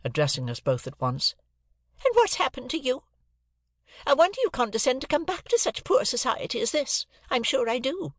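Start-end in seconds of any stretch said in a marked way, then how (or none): 2.1-8.1 high-pitched, sarcastic reproach; scolding sister. A narrator's reading. Sister scolds returning pair.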